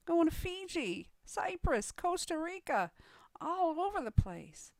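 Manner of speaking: high, wowed newcomer voice; amazed excitement. Acted.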